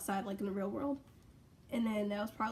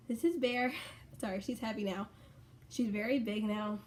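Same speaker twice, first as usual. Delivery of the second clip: ordinary delivery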